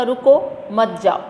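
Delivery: रुको set apart from मत जाओ; a clear "stop, don't go" phrasing: "रुको, मत जाओ"; pause after रुको. Shown as a demonstration. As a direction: punch रुको, break, then मत जाओ together. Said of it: "एक पर्सन ने ऐसे बोला".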